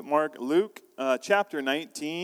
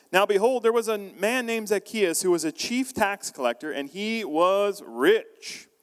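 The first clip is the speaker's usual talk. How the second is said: scripture reading; 5 semitones higher than usual. Acted.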